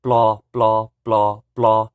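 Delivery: "Blah" loud with P sliding into L; teacher showing PL blend; Hmong pla. Shown as a demonstration. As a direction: drill "Blah" with L. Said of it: "just put an L on it".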